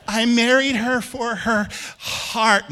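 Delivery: slow, high, hammed-up pious voice; drawn out like a saintly husband; mock pious husband. Pastor mocking a husband's claim, slow and high.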